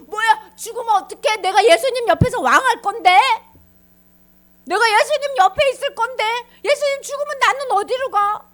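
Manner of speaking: high, loud, whining protest; hammed-up distraught wailing questions; panicked Peter. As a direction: complain in acted dismay, demanding answers. Peter panics about losing his place.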